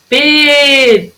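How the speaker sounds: "P" held long, like the call; sílaba esticada. Shown as a demonstration. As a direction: stretch the "P" out as in calling. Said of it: "que durou mais tempo". ("P" bem alongado, como chamando)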